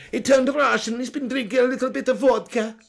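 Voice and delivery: lightly put-on accent; mock Russian-ish accent. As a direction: play up the accent for the joke. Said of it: "When did Jose turn Russian".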